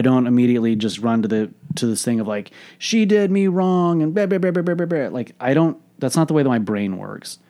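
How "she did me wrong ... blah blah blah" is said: high, loud mocking whine; mock complaining. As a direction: exaggerate a victim's rant into babble. Mocks his old self-pitying reaction.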